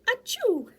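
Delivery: a high, small achoo; a dainty little sneeze. Acted. Butterfly giving a little sneeze in the jungle.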